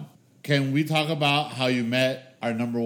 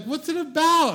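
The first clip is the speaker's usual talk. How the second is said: very high imitation of older ladies; sweet innocent ladies; 13 semitones higher than usual. Sweet elderly friends asking about the show, innocent. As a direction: pitch it way up, innocently curious.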